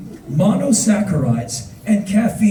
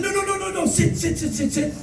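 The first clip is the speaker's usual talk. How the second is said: high, rapid-fire repeated protest; insistent; 13 semitones higher, 3 dB quieter, faster than usual. Retold or imitated. Bus driver keeps tourist from hiking, high and insistent. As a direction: imitate an insistent driver waving off the offer. Driver refuses to let him walk.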